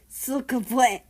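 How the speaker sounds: cut-off curse. Acted.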